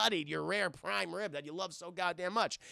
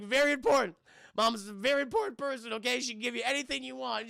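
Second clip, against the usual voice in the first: raised-pitch, hammed-up bargaining; exaggerated begging; mock pleading captive; 5 semitones higher, 3 dB louder, faster than usual. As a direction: plead urgently as an imitated hostage. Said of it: "My mom's like".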